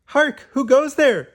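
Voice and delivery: high, loud hammed-up sentry call; mock guard challenge. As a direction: call out like a pretend castle guard. Early Bird plays guard at dawn.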